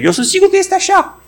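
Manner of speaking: very high, insistent mock conviction; mock certainty. Believer insisting on wrong memory, high mock-sure voice. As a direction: squeal out the false certainty.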